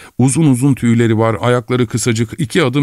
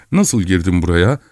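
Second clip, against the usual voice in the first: ordinary delivery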